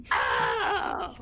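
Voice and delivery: low hammed-up wail; mock goblin cry. Game master voicing a crying goblin, mock wail.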